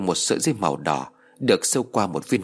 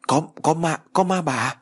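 scared stammer; 7 semitones higher than usual. A narrator's reading. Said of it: "run lên vì sợ hãi".

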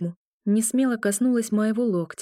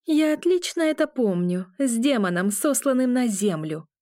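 haughty, pitched up high; 6 semitones higher than usual. A narrator's reading. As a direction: talk down to him from above. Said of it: "произнесла она высокомерно".

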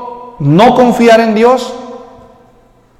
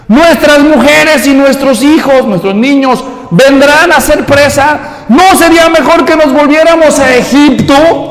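mock lament; 5 semitones higher than usual. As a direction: ham up their dramatic fear. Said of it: "Y dice". (lamento exagerado y agudo)

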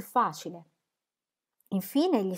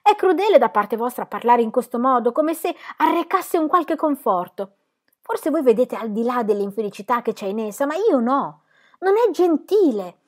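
higher and louder, bitter furious reproach; angry; 7 semitones higher, 7 dB louder than usual. Acted. Will angrily reproaching Dorotea for her comfort. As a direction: accuse her, voice raised in rage. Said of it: "disse Will con ira".

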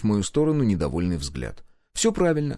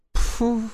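exasperated "pfff"; noisy exhale; 14 semitones higher than usual. A narrator's reading. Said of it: "с шумом выдохнула Гюрза". (шумный раздражённый выдох)

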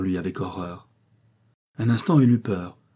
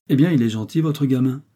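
higher, louder brusque remark; curt storybook irritation; 5 semitones higher, 6 dB louder than usual. A narrator's reading. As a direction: throw it out abruptly, annoyed. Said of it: "dit brusquement Bénard".

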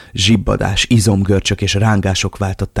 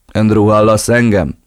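calling out; 6 dB louder than usual. Acted. Villain checks on captive.

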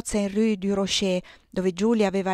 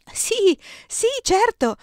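very high-pitched, wide-eyed astonishment; amazed agreement; 13 semitones higher, 4 dB louder, slower than usual. A narrator's reading. Giulia confirms her sister's news, high-pitched and astonished. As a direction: exclaim it high, rising to loud, in amazement. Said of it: "gli occhi le si fecero tondi dallo ... stupore".

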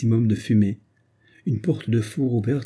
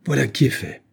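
higher-pitched bitter exclamation; crying out; 4 semitones higher than usual. A narrator's reading. Man raises his hand to the woman, crying out. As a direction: exclaim with restrained menace. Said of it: "s'écria Paul Marchmont".

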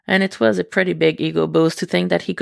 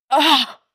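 a sudden high pained "Ah!", louder; sharp cry; 9 semitones higher, 4 dB louder than usual. Acted. Eddie cries out at the first thrust, high and sharp. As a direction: cry out as he's taken.

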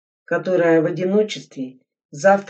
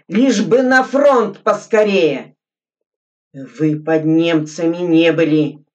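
loud, higher, furious tirade; angry shouting; 5 semitones higher, 8 dB louder than usual. Acted. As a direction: shout your defiance at the officer. Глебов кричит на лейтенанта.

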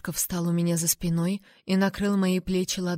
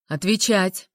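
barked order; 5 dB louder, slower than usual. A narrator's reading. King orders an answer.

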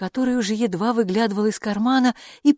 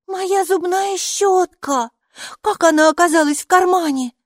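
put-on astonished child; 12 semitones higher, 6 dB louder than usual. A narrator's reading. Майки finds her toothbrush in the pocket, high astonished voice. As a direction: exclaim in a high, amazed child's voice.

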